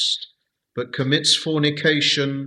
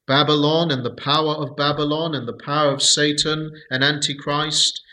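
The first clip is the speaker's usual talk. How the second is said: normal talking voice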